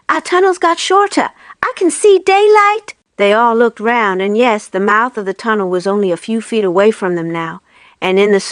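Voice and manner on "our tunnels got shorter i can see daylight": a little cub seeing light ahead; small fox, amazed. A narrator's reading. A cub telling its family, amazed. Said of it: "said one of the small foxes".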